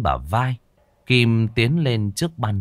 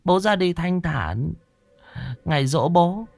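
woman's murmured prayer; 9 semitones higher than usual. A narrator's reading. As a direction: pray gently to the dead father. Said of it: "Kim rì rầm khấn".

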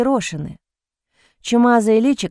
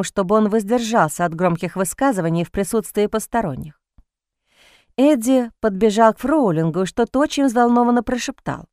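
ordinary delivery, nothing marked

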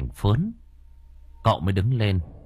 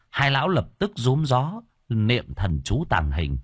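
ordinary delivery, nothing marked

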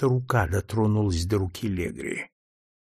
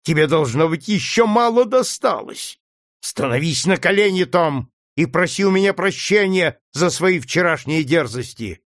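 higher, louder harsh command; angry demand; 6 semitones higher, 4 dB louder than usual. A narrator's reading. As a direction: demand he kneel. Legree threatening Tom.

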